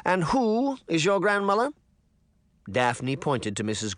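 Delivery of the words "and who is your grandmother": pompous mayor. A narrator's reading. Mayor questioning girls.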